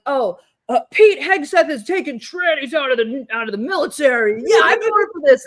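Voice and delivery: loud, high mock gloating, rising to a shout; mock maga cheer. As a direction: crow it like a smug supporter. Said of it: "when they're like".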